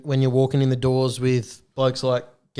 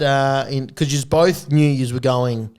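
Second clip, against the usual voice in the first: ordinary delivery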